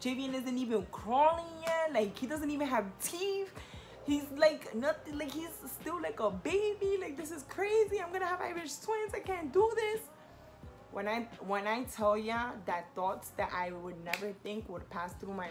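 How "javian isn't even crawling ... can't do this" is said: panic. Retold or imitated. Retelling her thoughts after the positive test, rising panicked voice. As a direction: voice climbing much higher, rising panic. Panicking over a new pregnancy.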